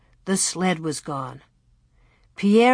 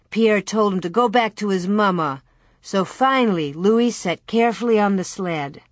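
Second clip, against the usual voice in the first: usual speaking voice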